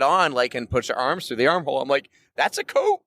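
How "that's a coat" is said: much higher, squeaky surprise; high squeak at realizing the blanket was a coat; mock realization. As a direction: squeal the discovery.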